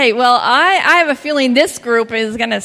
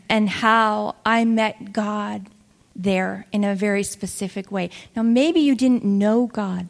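ordinary delivery

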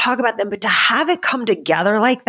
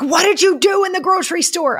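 mock-impatient high-pitched quoting of nosy people; nagging fan question; 11 semitones higher than usual. Podcast guest mimicking fans who ask her. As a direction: ask it like an eager listener demanding the ending. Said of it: "People are like".

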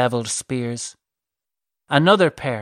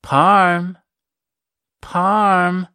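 raised, higher-pitched drawn-out call; beckoning call, held long; 6 semitones higher, 4 dB louder than usual. A narrator's reading. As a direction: call a name out, pitch lifted. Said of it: "Cyrene's voice called out".

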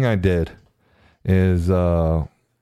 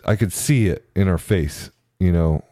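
usual speaking voice

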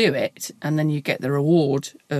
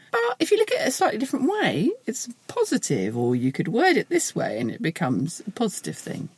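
much higher, fast chirpy positivity; relentlessly cheery fixer; annoyingly upbeat; 4 semitones higher, 3 dB quieter than usual. Retold or imitated. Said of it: "partly 'cause I'm really annoying".

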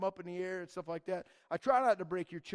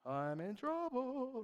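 worried aside; 6 semitones higher, 4 dB quieter, much slower than usual. Retold or imitated. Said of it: "and I'm going".

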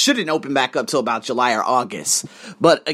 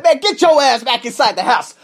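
mock cop yell; 9 semitones higher, 6 dB louder than usual. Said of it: "They said".

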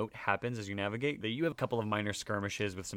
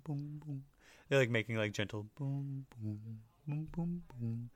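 soft booming noises; 3 semitones higher, 4 dB quieter than usual. Shown as a demonstration. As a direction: hum boom softly like pipes. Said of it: "they go boom".

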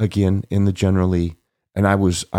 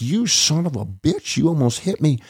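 furious; 8 semitones higher than usual. Retold or imitated. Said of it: "Paul looks at me like".

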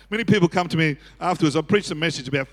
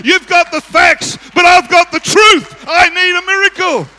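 high defiant shout, building to full force; crying out louder; 8 semitones higher, 9 dB louder than usual. Retold or imitated. Blind man refusing to be shut down, shouting. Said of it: "He cried out even louder".

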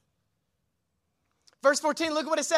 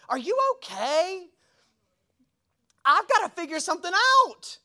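worried family; 7 semitones higher, 5 dB louder than usual. Retold or imitated. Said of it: "My family just thinks I lose".

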